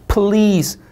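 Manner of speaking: "Please" with a clear, emphatic l; L sound. Shown as a demonstration. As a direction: press the tongue for the l in "Please". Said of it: "받침 있는 리을".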